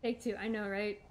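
ordinary talk

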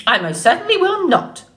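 high, affronted bird refusal; squawking old goose. A narrator's reading. Goose refusing the robot's request, high squawk. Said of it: "squawked the goose".

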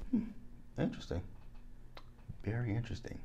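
soft, to oneself, with long pauses; quiet musing. Real, not acted. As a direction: ponder it privately, voice dropped. Musing at own reflection, distracted.

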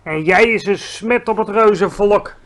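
loud contemptuous shout; shouted insult. A narrator's reading. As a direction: yell the insult at him. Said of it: "schreeuwde de bloedbottelaar". (luid scheldend geschreeuwd)

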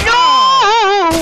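high, drawn-out wail of "no"; hammed-up cry. Player whose character was crushed, hammed-up high wail. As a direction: wail as if badly hurt. Crying out at game crash.

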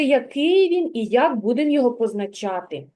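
ordinary delivery

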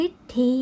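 "ฤทธี" as rit-thi, short then long i; two separated syllables in "ฤทธี"; model reading. Shown as a demonstration. Teacher reading the answer, careful pronunciation. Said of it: "จึงอ่านว่า".

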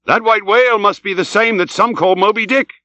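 high, eager harpooneer's voice; excited recognition. Acted. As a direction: pipe up with excited recognition, rising at the end. Tashtego excitedly names the whale.

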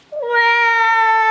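long, loud, high wail; sustained high-pitched wah; baby's scream. A narrator's reading. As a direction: shriek like a tackled little child. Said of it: "Emma screamed".

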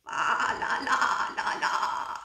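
slow breathy sleepy singsong; airy snoring singsong; snoring sheep. A narrator's reading. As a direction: let the sheep sigh it out in sleep. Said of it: "snores the sheep".

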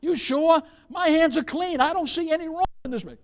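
high-pitched protest to the crowd; acted Pontius Pilate. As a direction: plead innocence to a mob, pitched well up. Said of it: "they shouted to Pontius Pilate".

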